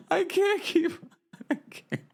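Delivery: high, breaking into helpless laughter; can't get the words out for laughing; laughing helplessly. Real, not acted. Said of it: "I need a moment".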